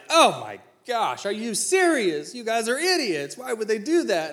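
mock outrage. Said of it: "everyone at the table is like".

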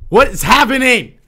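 high, loud shocked cry. Real, not acted. Alarmed about the echo.

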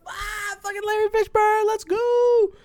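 very high mock cheer; put-on falsetto whoop of excitement; imagined fan reaction. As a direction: squeal it like a thrilled fan, hammed-up. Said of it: "you're just like".

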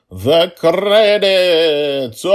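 very slow, high, loud announcement then laugh; mock grand announcer. Host announcing the credits, hammed-up slow loud fanfare. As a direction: ham up the fanfare. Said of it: "mock grand announcement".

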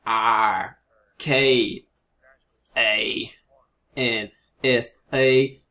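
slow drawn-out Arkansas spelling; Arkansas accent. Pretend. Co-host asked to spell it in an accent, Arkansas drawl. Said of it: "That's a really good accent".

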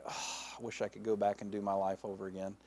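sighed, low and soft; acted regret, quick and hushed; wishful regret. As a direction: breathe out the oh, then quiet regret. Imagined regret on entering heaven.

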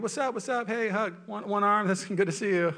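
hearty greeting. Retold or imitated. Man greeting churchgoers one-armed.

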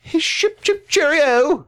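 mock British accent. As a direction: stretch it out in a silly British accent. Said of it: "a British accent would probably be best".

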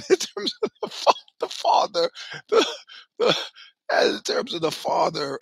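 high, laughing squeak breaking through the words; can barely get it out for laughing. Real, not acted. Laughing at his own fatherhood.

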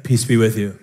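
a deep calm blessing; calm Jesus voice. Retold or imitated. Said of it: "Jesus is like".

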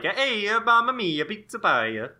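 high, loud hammed-up Italian accent; jolly italian pizza chef. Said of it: "Pistachio's like".

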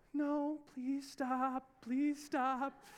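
drawn-out, high pleading. Retold or imitated. Father silently begging as the juice spills, slow high plea. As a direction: beg the juice not to spill, very slowly. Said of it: "And I'm like".

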